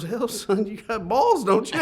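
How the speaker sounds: put-on a country boss impressed by the kid's nerve; Texan boss impression. Texan owner reacts to the request for the picture, in a twang. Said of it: "And he goes".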